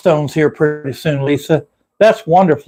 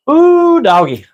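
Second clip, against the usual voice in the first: delighted coo; 11 semitones higher, 3 dB louder, slower than usual. Real, not acted. Sees a dog on stream.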